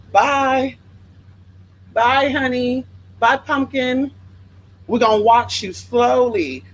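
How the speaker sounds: mocking goodbye to the haters; sassy bye. Real, not acted. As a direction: wave them off with sass.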